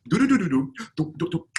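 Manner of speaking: high, loud nonsense syllables do do do; gibberish newsreading. Retold or imitated. Reading the teleprompter in a language she can't speak, babbling do do do. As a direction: fake a language you don't know with do do do. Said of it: "I was like".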